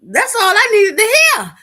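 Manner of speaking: high and loud, thrilled; loud and gleeful; excited loud declaration. Retold or imitated. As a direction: say it like you just got permission. Excited retelling of strip show.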